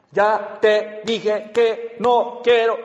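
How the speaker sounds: slow, hammered word by word; tono golpeado, scolding. Shown as a demonstration. Mother disciplining her child in a hammered, scolding tone. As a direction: hit each word separately like a disciplining mother. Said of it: "un tono de voz golpeado".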